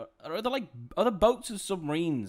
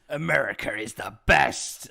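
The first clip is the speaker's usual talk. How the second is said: slow, loud, booming mock slogan; mock movie slogan; 2 semitones lower, 9 dB louder, slower than usual. Mocking the movie's message in a booming voice. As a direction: drawl it like a jingoistic tagline. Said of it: "'cause it is".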